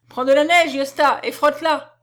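loud, high barked order; command. Acted. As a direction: give the urgent order. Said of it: "ordonna-t-il".

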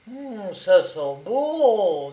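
slow, drawn-out, appreciative hum; savouring insinuation. A narrator's reading. Brigadier sniffing out a hidden meal, slyly savouring. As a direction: relish the smell with a knowing swoop.

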